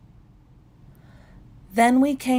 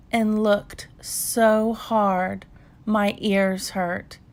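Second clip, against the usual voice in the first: ordinary delivery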